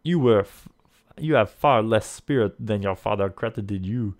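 put-on loud, scornful French accent; French-accented villain. Lafond belittles the player's spirit, French accent. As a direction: taunt loudly in a French accent. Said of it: "he asks in a light French accent".

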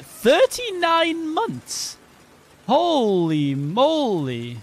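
high, hugely stretched-out whoop of amazement; amazed celebration. Real, not acted. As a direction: draw every word way out, pitched high. Cheering a long subscription.

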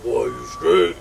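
slow, low, dragged-out stoned voice; slow stoned drawl. Retold or imitated. Friend checking on him, slow dragged stoned voice. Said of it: "like a slow ass".

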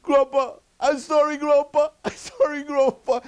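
put-on very high, loud toddler wail; crying granddaughter. Smacked toddler says sorry to grandpa, mock crying. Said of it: "she's crying, and goes".

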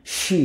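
সি as a long, hushing shee; drawn-out shee. Shown as a demonstration. Teacher shows accented Polish S, long সি. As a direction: stretch সি out, shee. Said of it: "একটু টান দিয়ে উচ্চারণ করে".

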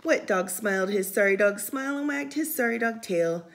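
ordinary delivery

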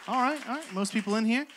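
ordinary talk, nothing marked

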